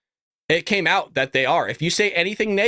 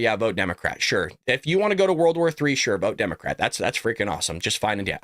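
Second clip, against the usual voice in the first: sarcastic; 8 semitones lower than usual. Real, not acted. Sarcastic advice to military voters.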